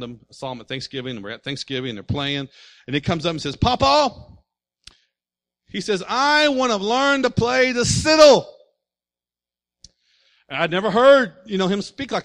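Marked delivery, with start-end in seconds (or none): 3.6-4.1 put-on higher, louder child's call; lightly voiced child calling Papa. Young cousin calling grandfather. 6.1-8.5 put-on higher, loud, slow child's shout; a little boy begging to learn fiddle; child's loud earnest plea; eager child. Lisping child asks grandfather.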